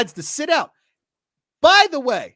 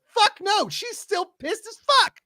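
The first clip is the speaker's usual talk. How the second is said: angry outburst; 11 semitones higher than usual. Real, not acted. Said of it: "I am angry".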